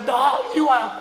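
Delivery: a boss yelling across the sauna; retold shout. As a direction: bark him over like a caught truant. Senior pastor catches him in sauna.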